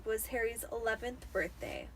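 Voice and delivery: plain reading voice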